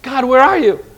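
pleading cry. Retold or imitated. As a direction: cry out in lonely searching. Believer feeling abandoned by God.

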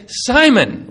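Simon called out high and loud; sharp rebuke. Acted. Jesus stopping Peter's sword strike, sharp loud call. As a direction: snap the name to stop him cold.

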